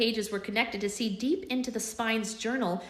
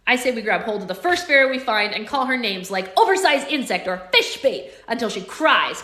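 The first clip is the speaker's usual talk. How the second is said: put-on loud scheming boy; 9 dB louder than usual. Connor's scheming loud plan.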